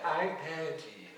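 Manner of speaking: slow, a hint of a languid drag queen voice; lightly voiced drag queen. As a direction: drawl it out slightly, a touch grand. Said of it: "And she said".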